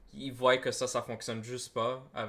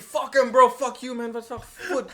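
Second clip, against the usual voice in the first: loud, high-pitched mock bragging to the boys; hammed-up tough-guy boast; boastful arrestee; 6 semitones higher, 7 dB louder than usual. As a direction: play the arrested guy hyping up his story. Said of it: "Il est comme, yo".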